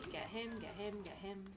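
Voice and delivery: usual speaking voice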